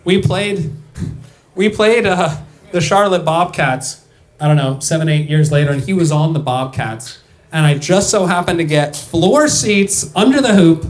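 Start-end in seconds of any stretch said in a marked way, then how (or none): none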